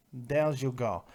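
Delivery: ordinary talk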